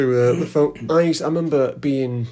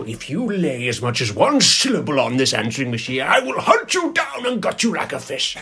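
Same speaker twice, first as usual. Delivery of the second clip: Grinch voice; 3 semitones higher than usual. Pretend. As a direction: threaten callers like a cartoon villain. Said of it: "pretending to be the Grinch".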